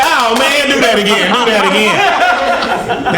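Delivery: high, excited encore demand; amazed begging. Retold or imitated. Officer amazed by a backflip, high excited begging. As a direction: plead for it with delight, stretching the last words.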